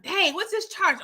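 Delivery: high, startled yelp at the bill; caught-off-guard shock. Retold or imitated. Viewer spotting a free-trial charge, mock startled yelp. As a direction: sound like a surprise charge just hit your account. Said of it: "You forget, like".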